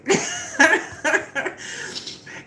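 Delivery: squealing laugh at the scene; high delighted laugh. Real, not acted. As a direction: crack up at the memory. Laughs at her own retelling.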